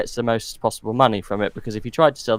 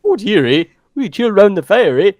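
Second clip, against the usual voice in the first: put-on a jokey Canadian accent, louder, ending on a drawn "eh"; hammed-up Canadian accent; 7 semitones higher, 7 dB louder than usual. Playing up the accent while his co-host laughs, in a hammed-up Canadian accent. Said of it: "What is that?".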